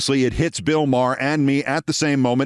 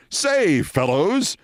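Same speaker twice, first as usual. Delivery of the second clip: put-on higher, sing-song old-boy "Say, fellows"; Scott's hearty greeting; 5 semitones higher, much slower than usual. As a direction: greet the fellows like a three-piece-suit man. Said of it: "as in".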